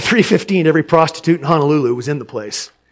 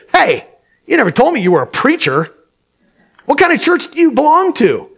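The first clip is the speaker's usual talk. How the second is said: slight hostility; 6 semitones higher, 4 dB louder than usual. Acted. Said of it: "a trace of hostility in his voice".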